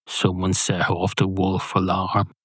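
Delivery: ordinary delivery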